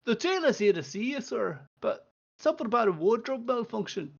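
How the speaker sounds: a lightly voiced guard announcing a visitor; a hint of a dutiful guard; guard reporting.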